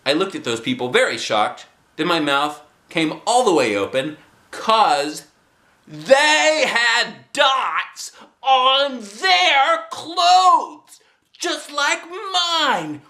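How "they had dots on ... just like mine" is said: much higher, slow astonished telling; a child stunned by the sight; amazed. A narrator's reading. Narrator astonished.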